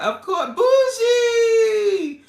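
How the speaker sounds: very high, loud, drawn-out cry of delight; excited shout-out. Real, not acted. Host thanking Bougie for the shout out, excited holler. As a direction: holler the nickname with real glee. Greeting a favourite viewer.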